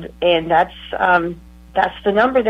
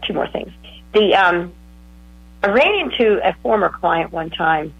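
ordinary talk

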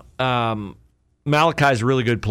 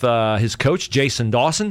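normal talking voice

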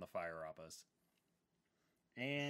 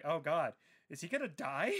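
mock worry; 6 semitones higher, 7 dB louder than usual. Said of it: "I was like".

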